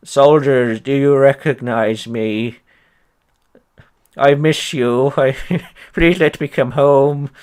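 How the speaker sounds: hammed-up pathetic emperor begging; mock whiny Napoleon. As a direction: whimper it like a homesick emperor. Mocking Napoleon's whiny speech.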